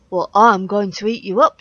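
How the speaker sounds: troll threat. A narrator's reading. Troll threatens the biggest goat, loud menacing voice. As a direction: threaten loudly like a hungry troll. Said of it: "said the troll".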